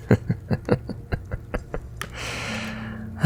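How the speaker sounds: soft, low-key villainous chuckle; breathy run of chuckles; amused chuckle. Acted. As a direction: chuckle quietly to yourself, amused.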